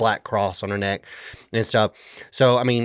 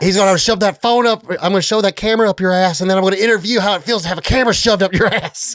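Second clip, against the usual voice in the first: high, loud mock threat; dad chasing off the kid with the camera, mock threat; threatening dad; 8 semitones higher, 3 dB louder than usual. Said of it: "threatens to shove the phone up his ass".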